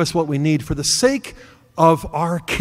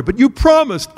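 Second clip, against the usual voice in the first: put-on protesting boy; 7 semitones higher, 3 dB louder than usual. Son protesting to his father.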